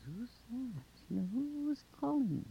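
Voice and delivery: high voice of a puzzled monster. A narrator's reading. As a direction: wonder who is out there, pitched high. Monster puzzled by an unseen caller.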